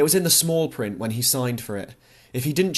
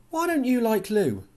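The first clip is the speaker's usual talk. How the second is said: Tom's teasing question; 11 semitones higher than usual. A narrator's reading. Tom voiced in a memory.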